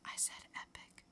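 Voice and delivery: hushed, playful "I said epic."; mock-guilty whisper. Reviewer whispers a joking confession to her co-host.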